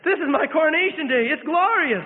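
very high, loud glorious proclamation; exultant. A narrator's reading. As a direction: ring it out in triumph. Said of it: "he again said".

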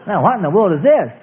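normal talking voice

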